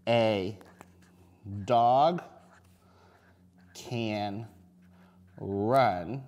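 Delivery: slow, word-by-word dictation; reading to a toddler. Shown as a demonstration. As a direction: say each word slowly as you write it. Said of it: "teacher dictates story while writing".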